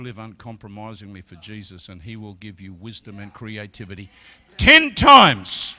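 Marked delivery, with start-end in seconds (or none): 4.6-5.7 loud, high-pitched sermon climax; full-force preaching. Acted. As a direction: preach it out, building to the roar.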